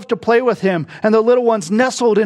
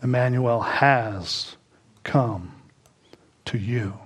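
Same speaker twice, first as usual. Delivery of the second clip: fading hymn recital; 9 semitones lower, 5 dB quieter, slower than usual. Real, not acted. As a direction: recite the hymn, softer and slower to a whisper.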